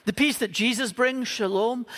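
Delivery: ordinary talk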